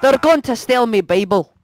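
put-on Irish accent; exaggerated Irish lilt; mock Irish. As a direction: play it in a stage Irish brogue. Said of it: "speaking Irish".

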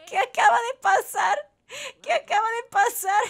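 high, loud squeal through laughter; cracking up in disbelief; helpless laughter. Real, not acted. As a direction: gasp it out mid laughing fit. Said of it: "de tanto estar riendo".

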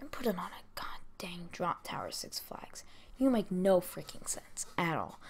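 hushed angry whisper. Real, not acted. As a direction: whisper the rant through teeth. Ranting aside dropped to whisper.

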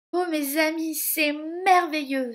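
high, loud, overjoyed; delighted exclamation. A narrator's reading. Cinderella thanking her friends for the dress, delighted. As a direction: marvel aloud at a lovely gift.

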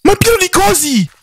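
high, startled alarm; real fright, pitched high; alarmed yelp. Real, not acted. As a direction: cry out in shock at the swarm. Swarmed by creatures in horror game.

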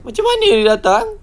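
very high, loud shriek; shrill-pitched panicked question; panicked disbelief. Real, not acted. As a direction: squeal the question in alarm. Scared as the baby reappears.

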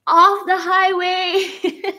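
slow, laughing through words; drawn out with giggles. Real, not acted. Laughing at a viewer comment.

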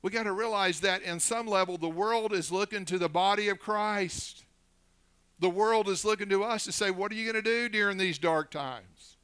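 preaching. Real, not acted. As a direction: press the warning home to the church. Preaches at full force.

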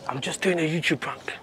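hushed whisper. Real, not acted. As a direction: whisper it so staff don't hear. Whispering to shopper about prank.

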